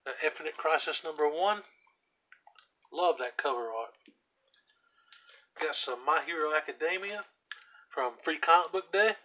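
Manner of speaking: ordinary talk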